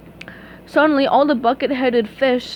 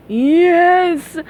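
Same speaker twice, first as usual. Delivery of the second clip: louder howling villain yes; louder triumphant villain howl; 6 semitones higher, 6 dB louder than usual. Acted. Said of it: "howled Plankton".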